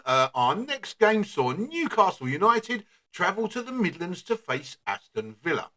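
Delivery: ordinary talk, nothing marked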